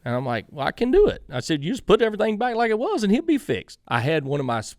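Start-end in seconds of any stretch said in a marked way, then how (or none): 0.5-3.8 higher, louder cocky comeback; cocky retort. Retold or imitated. Telling Pops he can fix it, higher and cocky. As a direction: toss it off with swagger. Arguing with Pops about setup.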